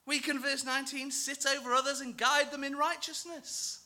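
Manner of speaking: high, self-satisfied voice with a laugh; smug religious boasting. Acted. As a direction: lord it over others, pleased with yourself.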